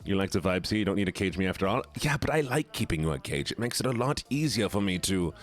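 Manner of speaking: put-on German accent. Streamer honouring a chat redeem, mock German accent. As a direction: speak English with a playful German accent. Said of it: "we have to do this German voice".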